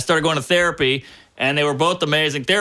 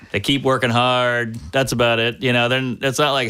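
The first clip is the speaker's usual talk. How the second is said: ordinary talk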